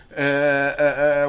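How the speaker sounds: ordinary delivery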